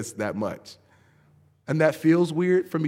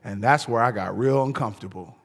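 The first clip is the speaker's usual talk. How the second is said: ordinary talk, nothing marked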